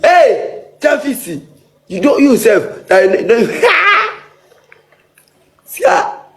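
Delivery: high, laughing teasing call; excited teasing. Real, not acted. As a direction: poke fun at her with a laugh.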